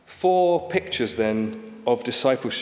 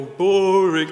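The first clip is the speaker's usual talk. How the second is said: put-on higher, louder teenage "Boring."; sulky teenager; 5 semitones higher, 7 dB louder than usual. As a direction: fling back "Boring." at dad. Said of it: "he would say".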